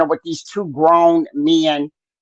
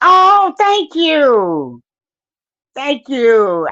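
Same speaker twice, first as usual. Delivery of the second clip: delighted thanks; 7 semitones higher, much slower than usual. Real, not acted. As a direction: gush out the thanks slowly. Thanking viewer warmly.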